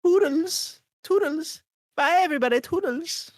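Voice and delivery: high, bright stage farewell; showman sign-off. Pretend. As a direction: wave goodbye like a star. Signing off like a showman.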